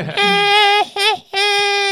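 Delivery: high cackling witch laugh. Acted. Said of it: "how good has my witch laugh gotten".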